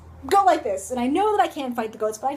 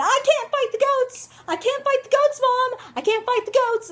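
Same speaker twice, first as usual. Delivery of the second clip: put-on high, frantic seven-year-old yelling for mom; hammed-up high-pitched child's yell; mock screaming; 10 semitones higher than usual. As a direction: scream it like a panicked kid. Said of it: "seven years old screaming".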